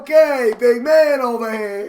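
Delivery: loud, high New York jeer; hammed-up New Yorker mocking someone; New York accent. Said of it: "spoke with New York accents".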